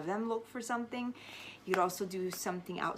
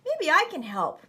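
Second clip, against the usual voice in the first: higher, louder eager call; shouting over the wind; 9 semitones higher, 8 dB louder than usual. A narrator's reading. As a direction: make yourself heard over the gale. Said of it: "I shouted over the wind".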